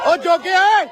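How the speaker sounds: loud call across the scuffle. Real, not acted. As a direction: shout his name to get his attention. Calling a friend during arrest.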